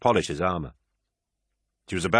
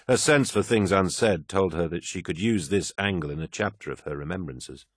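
usual speaking voice

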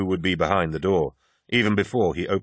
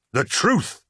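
much higher, sudden roar; 10 semitones higher than usual. Acted. Loken roars back at the poet's claim of truth. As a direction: explode on the word truth. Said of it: "roared Loken".

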